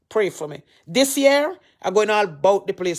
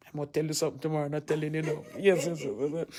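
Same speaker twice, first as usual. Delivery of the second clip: much softer and lower, keeping a secret; hushed teasing secret; 6 semitones lower, 11 dB quieter, much faster than usual. Real, not acted. Pastor teasing viewers with tomorrow's secret, hushed. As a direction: drop the voice like sharing gossip.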